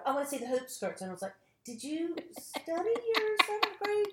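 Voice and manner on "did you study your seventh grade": high, incredulous mock question; sarcastic high pitched quip. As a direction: act out the disbelief at an ignorant question. Mocking question to outsiders.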